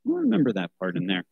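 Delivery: ordinary delivery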